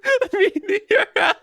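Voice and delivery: high squeaky laughing words. Real, not acted. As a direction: still cracking up. Still laughing hard.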